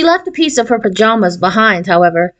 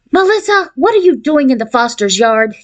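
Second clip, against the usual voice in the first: usual speaking voice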